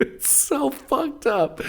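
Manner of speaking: high, giggly voice breaking into laughs; laughing helplessly. Real, not acted. Telling friend about the puppy, laughing helplessly. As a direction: struggle to tell it through laughter. Teller cracking up at own story.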